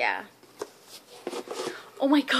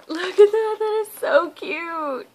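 much higher, louder, slow squeal; squealing over a cute gift; high cooing squeal; 8 semitones higher, 7 dB louder, slower than usual. Real, not acted. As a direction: gush like it's the cutest thing ever. Gushing over dog treats.

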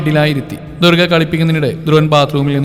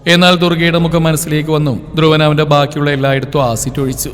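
plain reading voice

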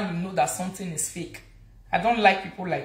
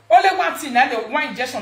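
sudden loud high exclamation; heated outburst; 4 semitones higher, 5 dB louder than usual. Real, not acted. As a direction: jump in much higher and louder. Heated rant, bursts in.

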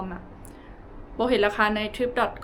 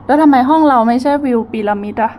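loud puzzled complaint; 7 dB louder than usual. Real, not acted. Can't find the promised pyramid view.

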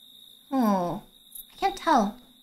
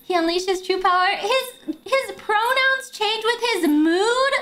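normal talking voice

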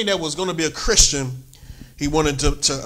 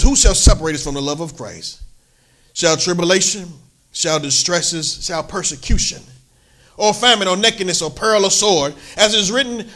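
preached scripture reading; 3 semitones higher, 4 dB louder than usual. Acted. Pastor preaching Romans eight to the church. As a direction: build through the list, then settle.

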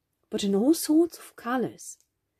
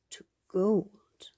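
usual speaking voice

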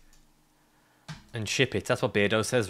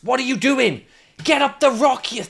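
high, loud frustrated rant; exasperated sweary outburst; 8 semitones higher, 5 dB louder than usual. Real, not acted. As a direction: splutter curses at the game, pitched right up. Frustrated outburst at failing game.

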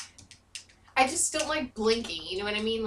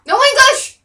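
high, loud frustrated outburst; flustered yell; 13 semitones higher, 13 dB louder than usual. Real, not acted. Gamer losing words mid-game, yelling in frustration. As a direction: blurt it out, words failing. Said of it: "I can't even speak English".

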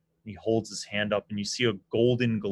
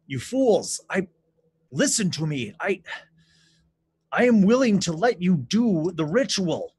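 higher, louder desperate king; urgent king pleading; 8 semitones higher, 7 dB louder than usual. Acted. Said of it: "he's like".

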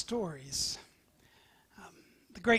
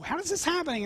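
baffled; 8 semitones higher, much faster than usual. Acted. Enemy king baffled.

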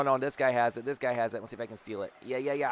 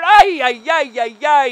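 high, loud run of yelps; excited alarm; 11 semitones higher, 12 dB louder than usual. Real, not acted. Player calling a squish alert, high excited yelping. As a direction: rattle it out loudly in mock alarm.

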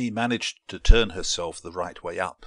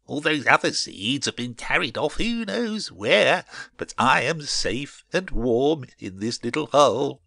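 higher, louder cheeky seed voice; high, self-satisfied character voice; smug little seed; 6 semitones higher, 5 dB louder than usual. A narrator's reading. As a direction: gloat as a cosy, pleased little seed.

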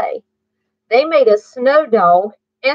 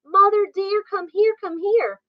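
higher sing-song plea; sweet call; 10 semitones higher than usual. A narrator's reading. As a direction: coax mother to come look. Kittens calling mother.

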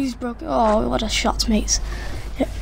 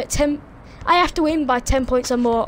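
ordinary talk, nothing marked

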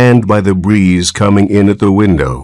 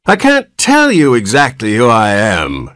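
put-on higher whiny old man; querulous whine; 5 semitones higher than usual. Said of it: "replied the querulous whine".

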